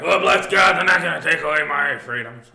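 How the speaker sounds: mocking defiant churchgoer. Pastor mocking a defiant churchgoer who ignores virus warnings, loud put-on voice. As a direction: ham up a stubborn churchgoer. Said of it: "be careful with this virus".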